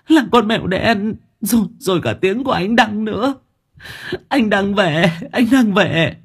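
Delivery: high-pitched, tearful panic; crying hard, voice high and broken; sobbing. A narrator's reading. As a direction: gasp out the words between frightened sobs. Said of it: "mà òa khóc nức nở".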